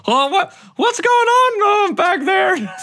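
very high, loud, startled cry; high character voice. Acted. Drona asking what's happening behind, high startled shout. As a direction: call out in a high-pitched put-on voice.

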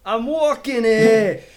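put-on loud, high, drawn-out New York accent. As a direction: holler it as a cartoon New Yorker. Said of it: "That's like the stereotypical".

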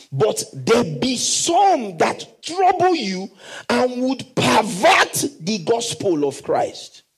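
very slow, lifted reading; slow scripture reading. Acted. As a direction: read it with weight, slowly.

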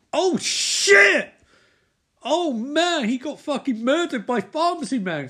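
very high, loud startled cry; real shock at a sudden death; shocked outburst. Real, not acted. Recapper reacting to the murder, high and loud.

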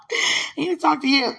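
loud, high giggly plea; playful pleading. Real, not acted. Speaker begs for a chance to talk, playful pleading. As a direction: beg with laughter bubbling round the words.

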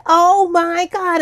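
slow, high drawn-out groan of disbelief; exasperated. Retold or imitated. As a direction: stretch it out in despair. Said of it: "And I would just freak out".

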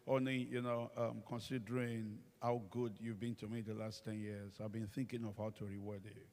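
soft, low coaxing voice; softly murmured endearment; sweet-talking husband. Retold or imitated. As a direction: coax her gently, keeping it low. Husband sweet-talking wife, retold.

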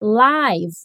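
live said with a long i, like "laiv"; live with the vowel of "eye". Shown as a demonstration. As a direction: rhyme live with five. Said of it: "a outra pronúncia com essa mesma escrita é live".

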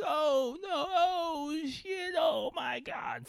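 high squealing, laughing dismay; stretched-out falsetto disbelief; reenacted freak-out. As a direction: imitate yourself wailing in helpless hysterics. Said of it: "you can just hear me like".

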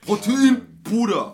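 gym bro voice. Retold or imitated. Mocking gym bros at parties.

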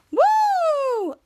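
long, very high "woo"; celebratory whoop. Real, not acted. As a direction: let out a relieved whoop. Celebrates finishing the recap.

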